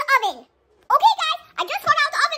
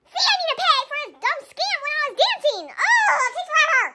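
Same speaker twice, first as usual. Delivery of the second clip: normal talking voice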